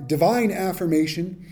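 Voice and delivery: normal talking voice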